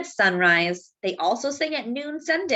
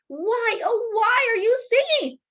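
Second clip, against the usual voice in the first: high, pleading cry of a desperate mayor; a hint of a desperate mayor pleading; 12 semitones higher than usual. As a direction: beg the rooster, voice climbing high.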